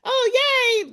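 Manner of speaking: high, loud delighted yay; group cheer. Retold or imitated. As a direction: cheer like the whole group is thrilled. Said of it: "everybody was".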